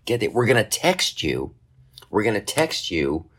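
usual speaking voice

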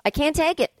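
ordinary delivery

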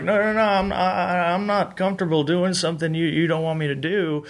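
mock uneasy protest with repeated starts; reluctant refusal. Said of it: "he wouldn't stand in front of the camera".

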